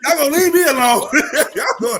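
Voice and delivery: loud, high, fast protest through laughter; laughing protest. Real, not acted. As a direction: holler it while cracking up. Teased speaker protests, laughing.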